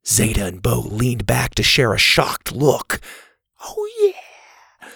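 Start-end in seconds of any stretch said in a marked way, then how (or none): 3.6-4.9 very high, soft squeal of glee; hushed triumphant squeak; silent celebration. A narrator's reading. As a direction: cheer without making noise. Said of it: "Zeta silently celebrated".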